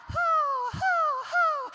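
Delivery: high, hooting owl call. Acted. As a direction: hoot like an owl, up high and loud. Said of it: "calls all the other owls in".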